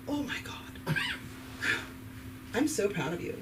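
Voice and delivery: soft, mock-awed congratulations; gushing neighbor. Neighbor congratulating a foreign graduate, gushing in mock awe. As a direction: quietly overcome with pride, imitated. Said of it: "My neighbor saw me, and she was like".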